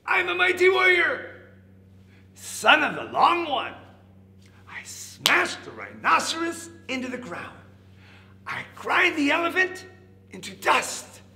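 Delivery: high, loud mighty-warrior boast; big boastful voice. A narrator's reading. Hidden caterpillar scaring off the rhinoceros, big boastful voice. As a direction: boom out swooping, high-pitched threats. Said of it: "the voice from inside said".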